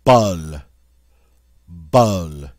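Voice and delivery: "Bol" with vowel between hot and hut; French short O. Shown as a demonstration. Teacher says French word for listeners, short O. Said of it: "somewhere between the short O in hot".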